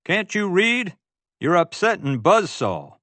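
high-pitched cowboy shouting a warning; shouted scolding. A narrator's reading. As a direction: yell it at a careless tourist. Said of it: "a cowboy shouted at him".

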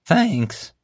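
high, sincere thanks; oblivious guard. Acted. Guard missing the boss's sarcasm, pleased. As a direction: pitch it up, taking sarcasm as praise. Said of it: "failing to grasp J J's sarcasm".

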